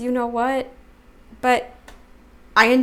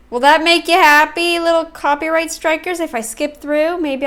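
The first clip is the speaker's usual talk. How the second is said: mock taunting; 6 semitones higher, 7 dB louder than usual. Taunting the copyright strikers.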